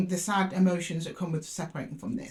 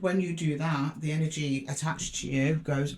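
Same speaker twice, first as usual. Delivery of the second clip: normal talking voice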